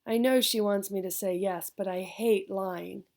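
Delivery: plain reading voice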